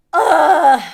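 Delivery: loud strained groan of disgust. Real, not acted. Streamer fed up with critics, loud disgusted groan. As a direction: let out an exasperated loud groan.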